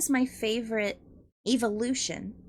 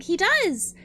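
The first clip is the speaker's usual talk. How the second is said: excited; 8 semitones higher, 5 dB louder than usual. Real, not acted. Excited agreement.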